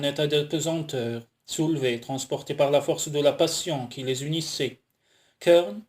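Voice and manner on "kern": louder, rising call of a name; a touch of urgency, voiced rather than whispered; pressing call. A narrator's reading. Woman calling her lover as passion takes over, mildly pressing. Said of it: "chuchota-t-elle d'une voix pressante".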